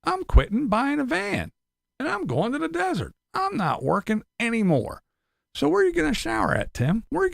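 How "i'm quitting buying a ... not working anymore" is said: high mocking voice; higher voice making fun of quitters; mocking quote. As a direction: mimic them in a higher, sarcastic tone. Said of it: "and they said".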